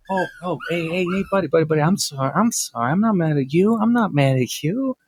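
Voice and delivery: fake-nice backpedal to the cohost; mock soothing. Boss fake-soothing his cohost after blowing up.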